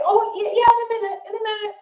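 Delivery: a child too busy playing to care; a kid absorbed in a game, answering absently; distracted kids. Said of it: "So they say".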